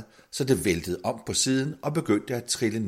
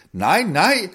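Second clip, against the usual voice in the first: højt og højere, råbende i panik; panisk råb; 11 semitones higher, 9 dB louder than usual. A narrator's reading. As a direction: skrig om hjælp inde fra karret. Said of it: "Manden blev panisk ved tanken og råbte". (panisk råb, meget højt og lyst)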